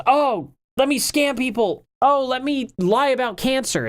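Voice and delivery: high, sneering mock of Boogie; mock him with a high sneer. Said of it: "And he goes".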